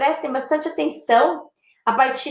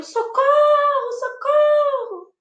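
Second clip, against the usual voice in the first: very high, long-drawn cry for help; acted plea, pitched way up; appeal for help; 14 semitones higher, much slower than usual. Shown as a demonstration. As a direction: call out for rescue, stretching the vowels.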